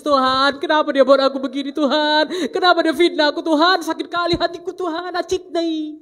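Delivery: loud, high wailing complaint to God; hammed-up tearful wail, rushing the plea; mock crying. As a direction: imitate a wronged person crying out in prayer. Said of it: "jangan nangis-nangis".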